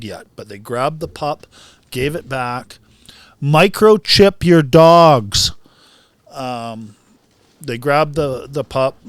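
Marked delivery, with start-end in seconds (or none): 3.4-5.5 loud, slow public-service call; emphatic announcement. Real, not acted. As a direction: drive the advice home, loudly and slowly. Emphatic advice to listeners mid-story.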